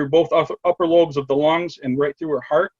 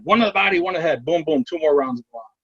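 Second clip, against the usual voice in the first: drill instructor; 2 semitones higher than usual. Retold or imitated. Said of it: "like a drill instructor in my head".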